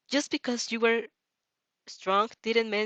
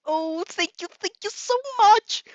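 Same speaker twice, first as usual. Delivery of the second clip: rising to a loud, high gush of gratitude; overjoyed thanks; 11 semitones higher, 6 dB louder than usual. Acted. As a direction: swell up into it, thrilled. Reader thanks Izuku, hugging him.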